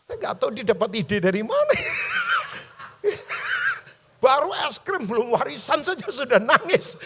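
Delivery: loud, high voice breaking into laughter; laughing out loud. Real, not acted. Preacher tells congregation his child's joke, laughing loudly. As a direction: crack up while nearly shouting it.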